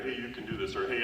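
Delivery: ordinary talk, nothing marked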